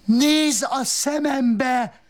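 very high, slow voice; mock howl. Roberto howling at his wife, very high and slow. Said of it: "üvöltötte".

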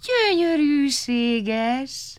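very slow, drawn-out, awed gyönyörűséges; amazed. A narrator's reading. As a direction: marvel at it, stretching every sound. All marvel at cloud maker.

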